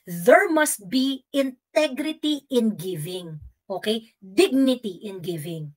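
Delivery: each word laid down very slowly and firmly; slow down and let every word land; slow emphatic point. Real, not acted. Drives home her moral point. (mabagal at mariing pagdidiin)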